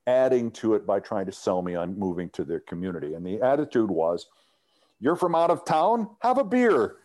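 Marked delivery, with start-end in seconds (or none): 5.0-7.0 jovial welcome. Retold or imitated. Chicago's welcoming attitude quoted.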